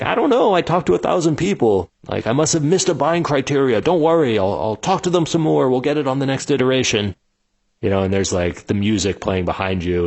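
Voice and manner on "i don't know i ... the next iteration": much higher, defensive and panicky; flustered founder. Retold or imitated. Founder excusing himself to his team, flustered and high. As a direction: scramble for excuses. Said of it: "And you're like".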